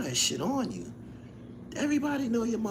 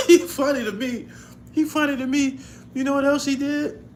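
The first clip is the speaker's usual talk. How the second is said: delighted, louder, barely holding in the laugh; loud amused chuckle between words; laughing loudly; 7 semitones higher, 5 dB louder than usual. Real, not acted.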